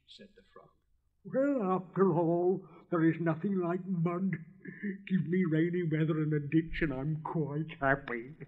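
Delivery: bubbly, talkative little frog; chatty frog. Acted. Frog greeting the rocket in the ditch, loud and cheerful. Frog's voice.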